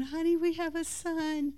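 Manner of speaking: lightly voiced new mom telling her husband; tender announcement. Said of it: "I'm laying there going".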